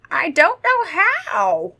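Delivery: high, loud, drawn-out vampire lament; slow theatrical admission; sighing vampire. A narrator's reading. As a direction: draw it out slowly as a helpless old vampire. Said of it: "He sighed again".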